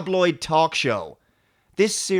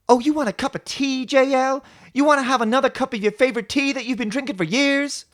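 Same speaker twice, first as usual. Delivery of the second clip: high, loud mocking complaint; Raffi; 11 semitones higher, 5 dB louder than usual. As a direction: nag with incredulous questioning. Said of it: "Will Raffi once again complain about everything".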